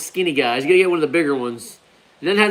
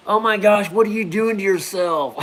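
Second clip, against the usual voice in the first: imitating worried mom; 5 semitones higher than usual. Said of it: "she really rebuked me".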